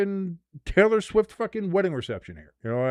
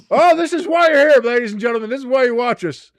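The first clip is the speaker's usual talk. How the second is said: high, raised announcer pitch; hyped-up address to the audience; mock showman; 7 semitones higher, 6 dB louder than usual. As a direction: proclaim it to the crowd, playfully.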